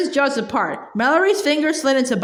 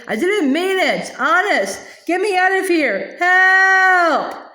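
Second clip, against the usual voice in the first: desperate goblin plea; 6 semitones higher, slower than usual. A narrator's reading. Hobgoblin caught in the griffin's beak, begging, then yelling for help. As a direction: beg to be let go, ending in a yell.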